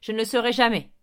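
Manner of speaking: loud, vehement refusal; crying out. A narrator's reading. David swearing to the headmaster he never will, crying out. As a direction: shout it out in defiance. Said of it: "s'écria David".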